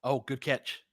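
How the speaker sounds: usual speaking voice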